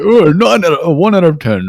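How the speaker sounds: high, loud mock judging call; excited rating call. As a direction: announce the score like a hyped judge. Rating pictures, mock judge quote.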